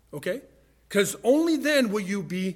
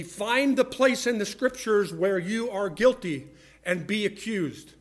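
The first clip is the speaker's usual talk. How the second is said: ordinary talk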